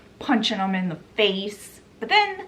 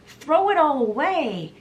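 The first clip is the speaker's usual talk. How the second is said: higher-pitched imitated cry to a crowd; mock yell; 7 semitones higher than usual. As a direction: retell a rousing shout, only moderately raised. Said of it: "he yells out".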